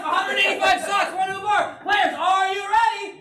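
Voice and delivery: high-pitched, rousing host call; hyped game-show shout. Real, not acted. Host asking the players if they are ready, hyped shout. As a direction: whip the crowd up to start the round. Host launches the round to crowd.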